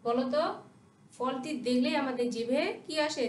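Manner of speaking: usual speaking voice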